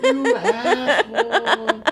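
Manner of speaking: high, very slow mock whimper; imitated tearful reproach. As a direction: drag it out like a shaken, wailing child. Scared daughter hugging him, imitated.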